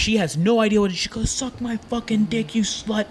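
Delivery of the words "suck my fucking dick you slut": slow, high mock tirade, softer in volume; drawn-out insult, pitched high; retold verbal attack. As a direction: imitate someone going after her, held back in volume. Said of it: "Just going after her".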